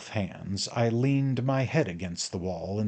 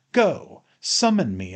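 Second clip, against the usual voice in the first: loud, high burst of anger; explosive angry command; roared; 5 semitones higher, 6 dB louder than usual. A narrator's reading. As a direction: roar it as a furious dare. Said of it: "I roared".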